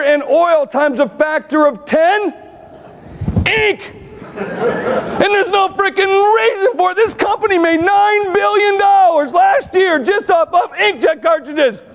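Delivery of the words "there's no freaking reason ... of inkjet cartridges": indignant outburst. Real, not acted. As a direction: shout the figure out in disbelief. Ranting about ink profits.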